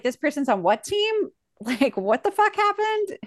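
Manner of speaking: rising into a high, incredulous squeak; shocked disbelief. Retold or imitated. Host recalling the summer trades, high and incredulous. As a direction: act baffled at the team news. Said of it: "I was like".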